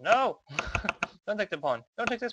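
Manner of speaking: sharp high shout of alarm. Real, not acted. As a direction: yell no in dismay. Opponent about to take pawn.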